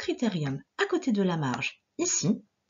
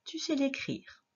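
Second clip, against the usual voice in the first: normal talking voice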